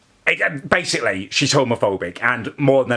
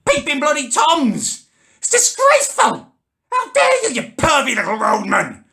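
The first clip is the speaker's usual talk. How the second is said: shrill, much higher, indignant outburst building to a squeal; scandalised squeal building higher; outraged shriek; 12 semitones higher than usual. Acted. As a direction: clutch your pearls and shriek at the peeping workmen. Outraged at leering workmen.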